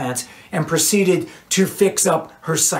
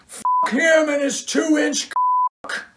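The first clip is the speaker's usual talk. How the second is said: high, angry scream; thrown-out groupie screaming to her friend outside; 11 semitones higher, slower than usual. A narrator's reading. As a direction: spit it out in rage. Cheryl rants to Marcia.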